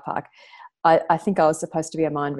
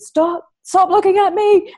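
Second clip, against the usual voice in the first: much higher, louder, slow retold yell; slow, higher, louder angry yell; 12 semitones higher, 7 dB louder, slower than usual. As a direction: play the furious bikie yelling at her. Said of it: "And he was really yelling".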